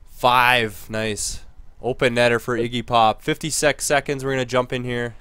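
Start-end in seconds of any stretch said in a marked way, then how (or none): none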